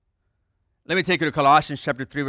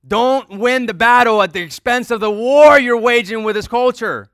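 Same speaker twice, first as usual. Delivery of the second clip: loud, high, driving sermon voice; forceful preaching; 9 semitones higher, 12 dB louder than usual. Acted. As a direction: preach it out to the whole room.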